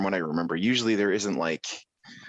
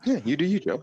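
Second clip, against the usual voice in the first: mock singsong; 8 semitones higher than usual. Echoes the mock line.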